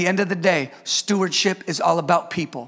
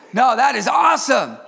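usual speaking voice

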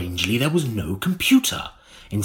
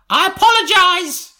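put-on much higher, louder old crone voice; old woman voice; 5 dB louder than usual. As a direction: crank it up to a shrill crone. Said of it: "deep, rasping voice".

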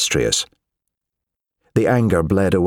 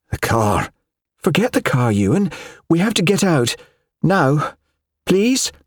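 urgent pleading; 10 semitones higher than usual. Acted. Wife begging husband to leave car.

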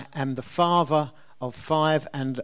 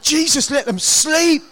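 higher, louder mock desperate prayer; half-comic higher, louder plea for the baby to sleep; weary parent's plea; 11 semitones higher, 7 dB louder than usual. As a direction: beg like an exhausted parent at a whimper. Tired parent hearing baby whimper.

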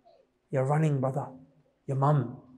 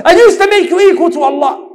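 high, very loud cry from hellfire; anguished outcry; 13 semitones higher, 13 dB louder than usual. Acted. Voices woman regretting in hellfire.

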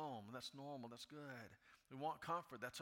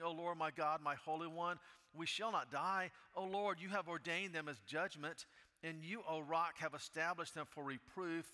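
loud, high impassioned preaching; preaching at full force; 5 semitones higher, 5 dB louder than usual. Acted. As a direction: cry it out to God with full fervor. Preaching second complaint at full force.

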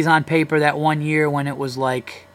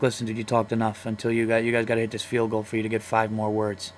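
lower, softer mock monitor voice; mock bar monitor; 4 semitones lower, 5 dB quieter than usual. Imagined monitor rationing a Lions fan's words, lower voice. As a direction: play a bored rule enforcer at the bar. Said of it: "be like".

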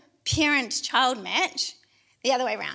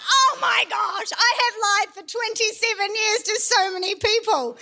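mock horror; 8 semitones higher, 3 dB louder than usual. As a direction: overplay the horrified confession, high-pitched. Acts out horrified discovery.

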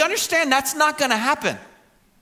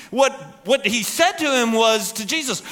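ordinary talk

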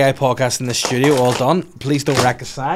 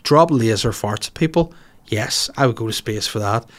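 normal talking voice

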